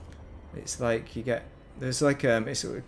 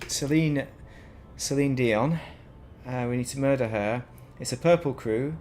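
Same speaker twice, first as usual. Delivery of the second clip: ordinary talk, nothing marked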